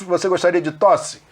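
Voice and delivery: normal talking voice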